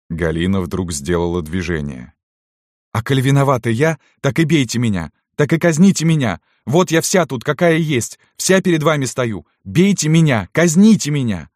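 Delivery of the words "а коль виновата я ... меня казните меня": put-on high, loud cry of a cornered girl; desperate outburst. A narrator's reading. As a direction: throw yourself forward, begging to be punished. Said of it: "Голос её на последнем слове оборвался". (отчаянно, высоко, с надрывом)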